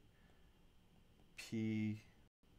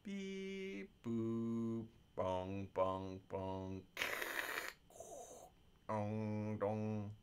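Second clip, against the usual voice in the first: beep boop noises; much slower than usual. Pretend. Waiting for a slow stream.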